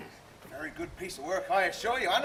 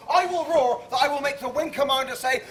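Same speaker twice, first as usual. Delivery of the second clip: ordinary talk, nothing marked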